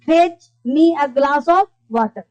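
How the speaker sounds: very slow model order; slow command. Shown as a demonstration. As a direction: spell out the command slowly. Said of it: "fetch का मतलब होता है लाना". (धीमे स्वर में आदेश वाक्य)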